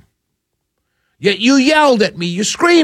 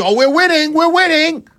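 mock gloating; 9 semitones higher than usual. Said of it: "who comes to you and tells you".

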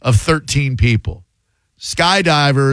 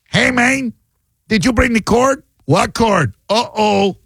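put-on high caricature South American accent; 8 semitones higher than usual. Host playing the careless bungee crew, mock South American accent. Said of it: "People associate the South American accent like a".

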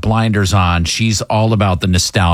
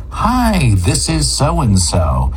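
friendly phone greeting; 3 semitones higher, much slower than usual. Shown as a demonstration. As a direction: demonstrate a courteous caller introducing themselves. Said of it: "You're supposed to be friendly".

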